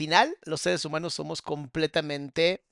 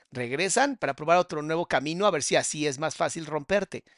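ordinary talk

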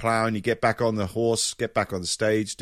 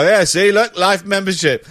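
loud, high proud boast; 7 semitones higher, 7 dB louder than usual. Retold or imitated. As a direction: wave the card and brag. Showing old card to club staff.

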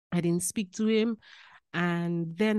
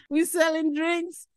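proud excitement; 9 semitones higher, 9 dB louder than usual. Real, not acted. Proud retelling of selling out.

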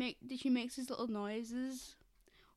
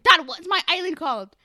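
louder, demanding; spoiled girl calls out to her dad for attention, loud and whiny; loud bratty daughter; 5 semitones higher, 13 dB louder than usual. Retold or imitated. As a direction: shout it up high like a spoiled kid. Violet voiced asking dad.

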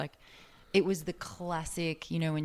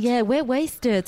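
slow, loud, high partying drawl; mock drunk; 8 semitones higher, 7 dB louder, much slower than usual. Mocking old party tweets, slurred drawl. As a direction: drag it out like a drunk reveller.

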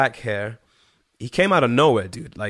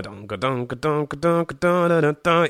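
vocal guitar riff; 3 semitones higher than usual. Retold or imitated. Imitating the rival's guitar riff.

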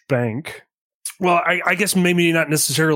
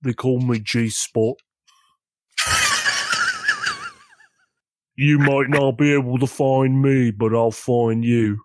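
a hint of a threatening tough guy, unhurried; menacing character voice. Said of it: "How deep of a voice?".

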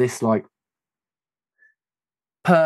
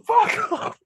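very high, strained retold cry; exasperated outburst. Engineer pinning the man to the wall, high strained mock outburst. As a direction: squeal it out, half laughing.

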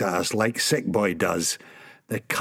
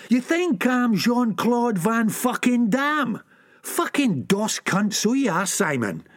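ऊँचे सुर में गुस्से से बहस; भड़ककर दोस्त पर बरसना; heated quarrel; 11 semitones higher than usual. Acted.

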